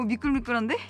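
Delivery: ordinary talk, nothing marked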